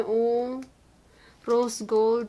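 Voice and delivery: usual speaking voice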